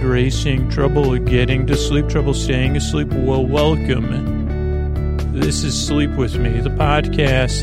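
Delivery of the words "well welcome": ordinary delivery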